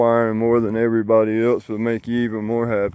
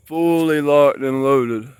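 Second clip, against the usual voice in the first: excited; 4 semitones higher, 6 dB louder, slower than usual. Real, not acted. Proud of a fully loaded tree.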